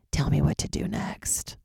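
slow, soft whispered plea. Retold or imitated. Staring into the ocean for inspiration, a slow whispered plea. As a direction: breathe it out to the ocean.

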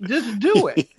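laughing through the punchline. Real, not acted. Guest laughing at his own joke about cheating on tests. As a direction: crack up as you say it.

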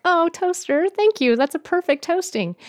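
high, gushing thank-you; sweet gushing to toaster. Pretend. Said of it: "I'm like".

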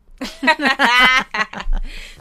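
loud giggle before a touchy topic; nervous laugh. Real, not acted.

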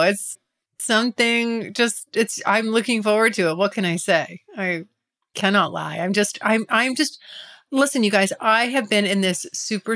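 usual speaking voice

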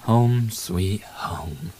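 very slow, contented whisper. Real, not acted. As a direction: breathe it out slowly like finally being home. Arriving home, relaxed.

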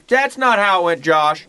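a hammed-up fatherly rebuke; dad phoning to correct his son; imitating dad. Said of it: "my dad will call me and he's like".

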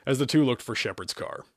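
plain reading voice

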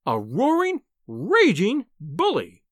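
high, slow, emphatic outrage; acted fury, drawn out; furious insult. A narrator's reading. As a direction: spit the insult out word by word. Wife insults husband in quarrel.

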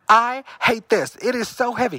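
high, strained complaint; whine-yell it across under your breath; acted-out hushed protest; mock whisper-yelling. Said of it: "quiet kind of, uh, whisper, yelling".

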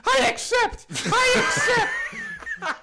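high goofy puppet yelling; Puppet George voice. Pretend. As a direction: yell it like a squeaky sock puppet. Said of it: "in the Puppet George voice and just start yelling".

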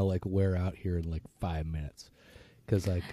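hoarse sore throat voice; rough throat, fading voice. Real, not acted. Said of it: "a really bad sore throat".

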